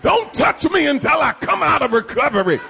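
ordinary talk

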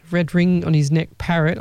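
ordinary talk

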